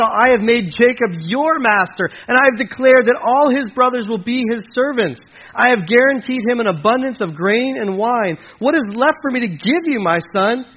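high, loud, declamatory reply; emphatic Isaac. Acted. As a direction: act out a distressed explanation. Acts Isaac's anguished answer to Esau.

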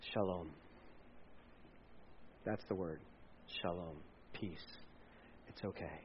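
calm a frightened man, barely above a breath; gentle reassurance. Acted. The Lord calming terrified Gideon, hushed and tender.

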